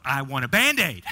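put-on loud kid wail swooping up on the last word; mock child's yell. Imitating a fallen boy demanding a bandage, mock yelling. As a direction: holler it like a hurt little kid. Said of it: "He began to yell".

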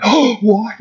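mock shocked gasp. Preacher mimics scandalized Sadducees: mock shock. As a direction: play the offended scholars, lightly.

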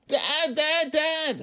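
high, loud panicked screams; frightened child screaming; terrified scream. Acted. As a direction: scream for dad in sheer terror.

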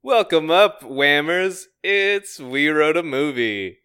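hammed-up podcast host welcome; podcast intro. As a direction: announce the show like a host. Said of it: "leads me to wanna say".

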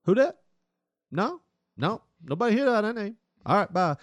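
hammed-up high street voice brushing off a call; guy on phone. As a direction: imitate a guy playing dumb on the phone. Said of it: "he goes".

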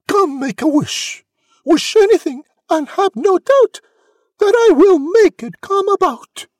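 very high, loud proclamation; fairy's cry. A narrator's reading. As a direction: proclaim it high, building grandly. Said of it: "she cried".

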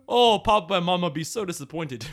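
put-on accent of a disappointed brother; Quicksilver. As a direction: scold a sister in a joke Sokovian accent. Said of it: "he has an accent and Wanda doesn't".

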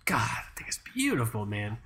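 hushed, breathy whisper of admiration; soft reverent whisper; awed whisper. Real, not acted. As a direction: whisper it like you're in love. Admiring watch.

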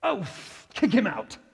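high mock British outburst; English accent impression. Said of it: "He's very colorful that way".

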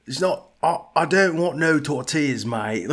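mock Cockney accent. Doing a bit about tortillas, mock Cockney accent. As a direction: play a Cockney dude from Mexico. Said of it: "I do a bit where I'm like".

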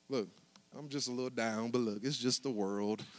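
soft mock brush-off; mock mumbled brush-off. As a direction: mumble it like hiding your trouble. Mocked brush-off answer.

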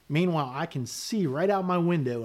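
normal talking voice

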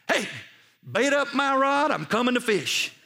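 high, loud holler; triumphant calling out. Retold or imitated. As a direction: holler it across the deck, raring to go. Calls out triumphantly from boat door.

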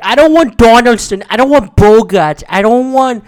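imitating a kid ranting at the radio; whiny kid rant. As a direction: yell it like a little kid fed up with his team. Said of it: "He's like".

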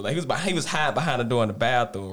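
normal talking voice

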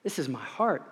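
gentle Jesus. Retold or imitated. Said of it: "and he says".

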